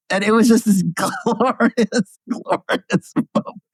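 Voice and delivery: high, breaking up with laughter; laughing. Real, not acted. Recalling the debate's funniest comeback, laughing. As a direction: laugh through the words.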